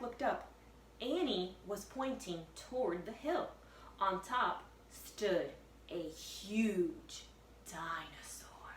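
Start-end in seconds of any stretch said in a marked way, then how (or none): 4.0-8.7 slowing to a whisper; slow hushed cliffhanger; suspense reveal. A narrator's reading. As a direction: drop to a hush on dinosaur.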